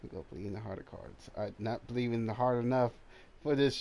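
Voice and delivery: normal talking voice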